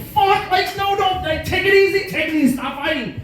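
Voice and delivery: high, panicked pleading; imitating panic. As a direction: beg them to calm down in a panic. Panicked trying to break fight.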